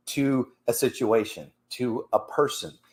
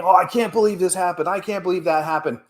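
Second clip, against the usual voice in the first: mock exasperated driver; 7 semitones higher than usual. Retold angry driver complaining.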